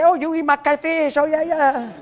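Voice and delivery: welcoming the preacher's guest, in a high, cheerful put-on voice; Frenchie the cook. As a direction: play the host proudly offering his catfish. Restaurant owner Frenchie greeting them.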